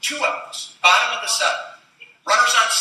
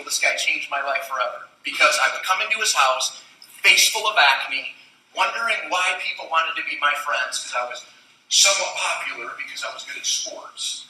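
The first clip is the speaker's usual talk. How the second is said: usual speaking voice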